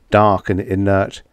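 ordinary delivery

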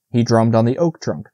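ordinary delivery

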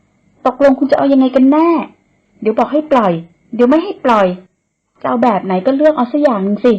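exasperated. Acted. Man carrying woman complains she can't decide, exasperated. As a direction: make her pick one, fed up.

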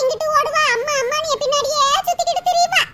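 put-on fond old mother. Mother delighted her daughter phoned, doting. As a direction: gush over the daughter who called.

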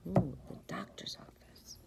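hushed, quick whisper. Real, not acted. Reader whispers to the child about the office. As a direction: whisper it like a secret.

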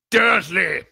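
loud, high roar of a name; booming shout. A narrator's reading. Giant summons the uncle to account, booming. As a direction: boom it out in outrage. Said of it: "he boomed".

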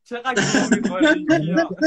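slow words through laughter; laughing at Kian's confusion, slow and loud; laughing tease. Real, not acted. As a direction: chuckle it out while mocking Kian. Teasing friend on live stream.

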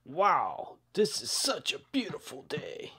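low hushed whisper. Acted. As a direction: breathe it secretly. Sneaky driver whispering.

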